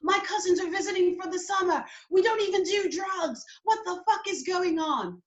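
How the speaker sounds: high, exasperated British voice; Heidi. Retold or imitated. British girlfriend answering police questions, high British accent. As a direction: imitate an indignant Brit, pitched well up. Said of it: "She's like".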